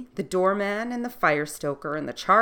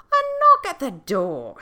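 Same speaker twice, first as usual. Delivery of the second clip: king voice; 9 semitones higher, 8 dB louder than usual. Acted.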